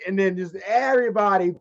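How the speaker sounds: ordinary delivery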